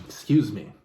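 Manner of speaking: ordinary talk, nothing marked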